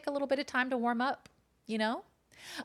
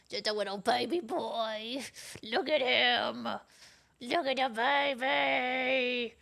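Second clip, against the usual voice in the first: exaggerated, drawn-out cutesy coo; slower than usual. Real, not acted. Mom gushing over her baby cat, exaggerated baby talk. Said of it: "I shouldn't talk like that".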